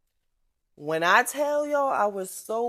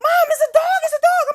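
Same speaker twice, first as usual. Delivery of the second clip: put-on high, loud frightened kid voice; scared child; 10 dB louder than usual. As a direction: cry it out like a panicked little girl. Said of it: "She's like".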